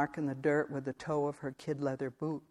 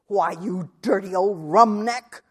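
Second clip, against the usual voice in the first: hoarse and louder, spitting contempt; 4 semitones higher, 8 dB louder than usual. Acted. Wife turning on her husband with a hoarse, scornful insult. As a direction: rasp the insult out at him. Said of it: "she said in a hoarse voice".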